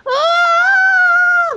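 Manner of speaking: piercing high scream at creepers; a wild shriek of fright; demo scream. Shown as a demonstration. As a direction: shriek like you've just seen a creeper. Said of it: "Like that".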